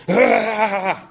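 imitated high frustrated "ah!"; frustrated outburst. Father mimicking his annoyed daughter at the piano, exasperated cry. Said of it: "she does like this. She goes".

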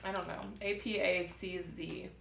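normal talking voice

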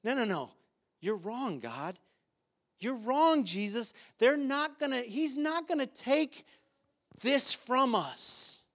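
defiant religious leaders. Retold or imitated. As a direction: play the proud leader who refuses to listen. Preacher voices leaders defying God.

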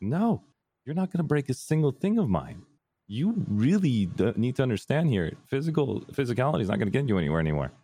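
high-pitched mock telling-off; retold scolding. Retold telling dad off, firmly.

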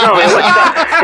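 high, laughing imitation of a friendly greeting; friendly musician greeting him, voiced high and laughing. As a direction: chuckle through a high, loud mock greeting. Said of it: "He goes, he goes".

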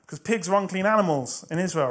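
ordinary talk

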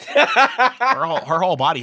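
breaking into laughter mid-word; laughing. Real, not acted. Laughing while retelling a friend's rant, breaking up mid-word. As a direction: let the laugh take over the words.

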